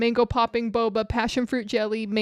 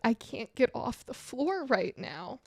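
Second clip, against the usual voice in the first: much softer, strained plea; weak in pain; 10 dB quieter, slower than usual. Retold or imitated. Telling the producer she can't stand, faint. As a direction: barely get it out to the producer. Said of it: "I'm just like".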